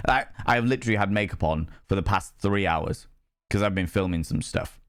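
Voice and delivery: ordinary talk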